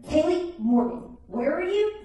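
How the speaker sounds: slow full-name summons, rising high; mock motherly alarm; imitated stern mom. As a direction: draw out the name, then pitch up the question. Mom demands daughter's whereabouts, retold.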